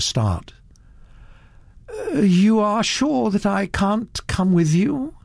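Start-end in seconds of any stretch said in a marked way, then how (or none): 1.9-5.1 slow, higher pleading question; hope she'll say yes, wistfully. Acted. Satterthwaite to Naomi, begging to ride along wistfully. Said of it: "he said wistfully".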